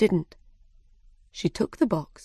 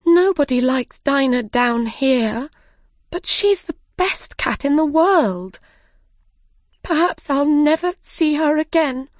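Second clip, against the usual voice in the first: upset Alice; 8 semitones higher, 4 dB louder than usual. Acted. Alice upset about her cat.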